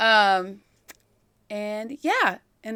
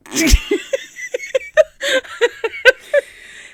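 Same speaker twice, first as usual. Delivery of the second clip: long laugh; 13 semitones higher, 6 dB louder than usual. Real, not acted. Laughs at own admission.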